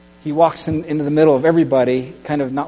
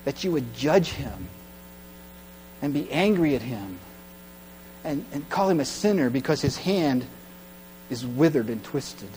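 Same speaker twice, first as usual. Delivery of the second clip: quiet rebuke; 3 semitones lower, 9 dB quieter than usual. Acted. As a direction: drop to a sober hush. Jesus's quiet rebuke continues.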